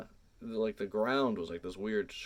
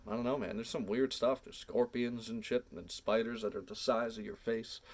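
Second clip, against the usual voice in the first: ordinary talk, nothing marked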